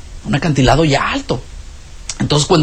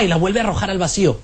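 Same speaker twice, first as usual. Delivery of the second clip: ordinary talk, nothing marked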